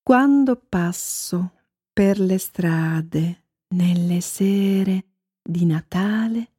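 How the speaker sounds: slow, wondering recitation with long pauses; poem recital. A narrator's reading. Narrator wondering about lights in houses, poetic. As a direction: recite slowly, musing line by line.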